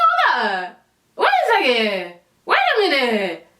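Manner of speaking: exaggerated squeaked double take; mock disbelief. Acting out her shocked reaction.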